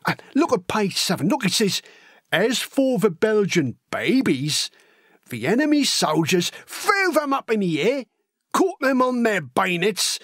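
lively, wide-eyed working-class lad spinning war tales; excitable storytelling with big pitch leaps; eager young Cockney recruit. Acted. Horace telling Elsie gruesome war stories, eager and excitable.

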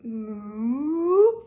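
slow, drawn-out moo; puppy moos. Acted. Puppy George at the vet, a long moo. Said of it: "George went moo".

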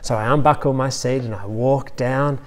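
ordinary talk

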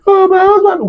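high-pitched mock wail of a grieving widow. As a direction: imitate a widow crying out for her husband. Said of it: "she started crying as a widow".

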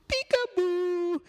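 very high, drawn-out delighted peekaboo; spring the surprise on the little ones; high peekaboo reveal. Acted. Reader revealing the cat to children, squealing high.

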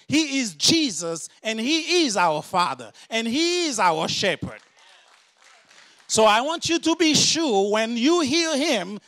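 full-force pulpit preaching. Acted. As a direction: drive the warning home to the church.

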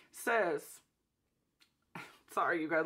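ordinary talk, nothing marked

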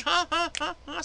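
ordinary talk

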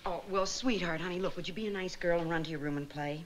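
low, soft, hurried sweet-talk; gentle coaxing. Acted. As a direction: coax a little girl to leave, softly. Coaxing a child out of room.

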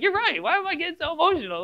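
high, quick imitation of a girl; imitated daughter. Pastor voicing his daughter coming round, high imitated girl. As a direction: lift it high like a daughter conceding. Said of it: "She's like".

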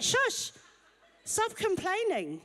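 high, laughing shush from the husband. Retold or imitated. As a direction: play the husband hushing her through a laugh. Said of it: "he's like".

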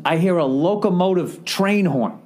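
normal talking voice

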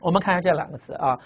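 ordinary talk

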